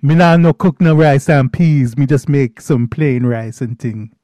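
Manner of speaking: put-on a playful Jamaican accent, half-hearted; mock Jamaican accent. Joking to cohost about his cooking, light Jamaican accent. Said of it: "My Jamaican accent".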